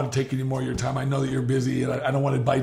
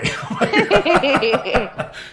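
big laugh; 12 semitones higher, 6 dB louder than usual. Real, not acted. Moved by daughter's words.

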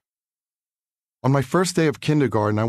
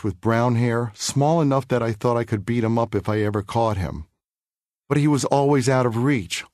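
usual speaking voice

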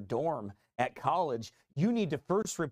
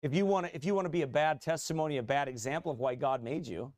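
ordinary delivery

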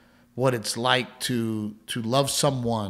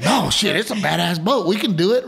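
higher, louder mock protest; insistent retold boast; 5 semitones higher, 5 dB louder than usual. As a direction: play an uncle eagerly talking her round. Acts out uncle persuading Blanca.

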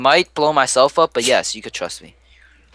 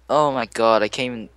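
normal talking voice

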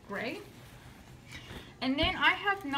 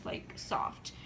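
whispering the word soft; hushed whisper; 6 dB quieter than usual. Real, not acted. Describing soft pajama-like fabric.

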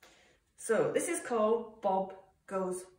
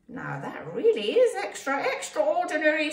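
high, loud exclamation; amazed praise; 8 semitones higher, 8 dB louder than usual. A narrator's reading. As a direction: marvel loudly, pitched way up. Al marvels at the creation.

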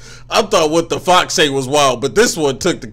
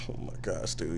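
a soft, breathy oh my gosh; breathless whisper; 9 semitones lower, 16 dB quieter than usual. Real, not acted. Stunned by the song, a soft breathy whisper. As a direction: let it out almost without voice.

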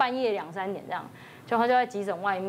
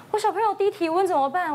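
anxious mother; 8 semitones higher, 3 dB louder than usual. Retold or imitated. Anxious mother rushing in worried.

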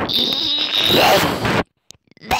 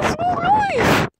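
much higher, squeaky dog voice; talking dog voice. Pretend. Voicing the dog.

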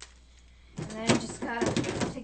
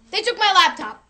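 loud outraged cry, higher; outraged shout; 4 semitones higher, 10 dB louder than usual. Pretend. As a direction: yell it in outrage. Villain discovers theft.

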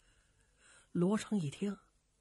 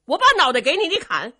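higher, louder provocation; daring taunt; 8 semitones higher, 12 dB louder, faster than usual. Acted. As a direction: goad him into swinging. Luo Cheng dares him to strike.

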